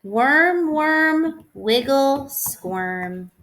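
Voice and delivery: sing-song rhyming read-aloud; reading to children. Acted. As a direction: bounce the rhyme for little kids. Reading a rhyming picture book.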